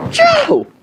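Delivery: shrieked high, loud sneeze; mock sneeze. Friend sneezing on the cashier, loud mock sneeze. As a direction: fire off an explosive fake sneeze. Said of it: "Sneezes all over the cashier".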